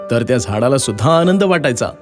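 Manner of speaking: ordinary delivery, nothing marked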